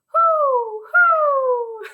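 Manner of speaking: loud high owl hoots. Pretend. Teacher answering the owl song for children, high hooting. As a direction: hoot out long like an owl. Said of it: "say hoot".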